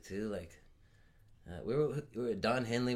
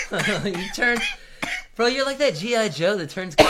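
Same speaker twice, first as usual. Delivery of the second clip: high, loud, cracking up; laughing; 12 semitones higher, 13 dB louder than usual. Real, not acted. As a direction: blurt it out through laughter. Laughing at friend turning purple.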